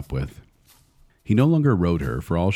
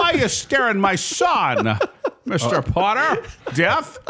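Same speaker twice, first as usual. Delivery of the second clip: high, slow, giggling parody; laughing mock voice; 14 semitones higher, slower than usual. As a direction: goof on the grandpa while laughing. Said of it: "The grandpa's like".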